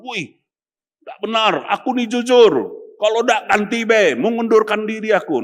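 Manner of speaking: hammed-up angry treasurer huffing; angry outburst. Shown as a demonstration. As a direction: blow up like an offended finance officer. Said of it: "Jangan gitu, ngomong".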